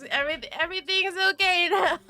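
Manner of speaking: fast, half-laughing, half-crying relief. Real, not acted. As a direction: giggle it out quickly, relieved. Laughing after fixing the broken face.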